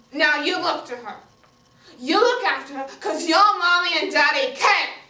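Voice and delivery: put-on loud, forceful order to a child; scolding cousin.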